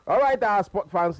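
high, loud announcer opening; radio host turning to the listeners, announcer voice; announcer call. Real, not acted. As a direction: hype up the sport fans. Host turns back to the listeners.

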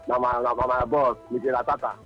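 ordinary talk

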